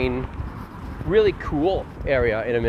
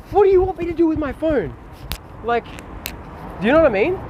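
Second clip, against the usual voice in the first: much higher, pleading disbelief; exasperated; 12 semitones higher than usual. Real, not acted. Streamer ranting to chat about phone stares, exasperated. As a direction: throw up your hands at the chat. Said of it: "exasperated rant to chat".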